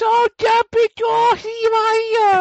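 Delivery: high, slow, whiny mock Sam. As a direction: whimper it like a pinched-nose whiner. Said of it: "says everything in a whiny voice".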